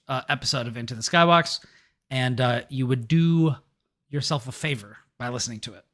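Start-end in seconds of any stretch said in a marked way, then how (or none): none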